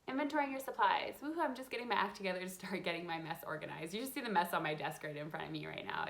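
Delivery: ordinary talk, nothing marked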